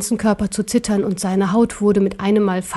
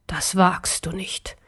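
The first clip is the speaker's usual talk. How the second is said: whispered, slow and much softer; frozen whisper; 3 semitones lower, 6 dB quieter, slower than usual. Acted. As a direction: breathe it out in shock. Emperor freezes, bowl raised.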